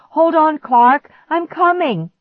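high, loud answering call; yell. A narrator's reading. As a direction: yell back urgently. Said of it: "I yelled".

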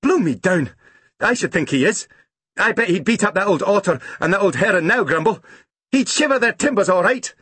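高声兴奋的老园丁，连连叫好; 像老水手似地乐呵呵地夸口; 老园丁惊叹. A narrator's reading. Grumble voiced by narrator.

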